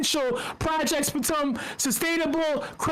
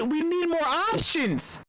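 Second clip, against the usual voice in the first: normal talking voice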